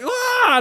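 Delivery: very high hammed-up scream; imitated agonized cry. Cop caught in a bear trap, mock agony.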